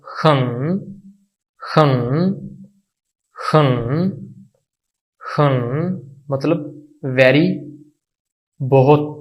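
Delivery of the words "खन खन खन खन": खन dipping then rising each time; third tone demo. Shown as a demonstration. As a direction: repeat खन with a fall-rise tone. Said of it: "तो इसको पढ़ा जाएगा". (खन को गिरा के उठाइए)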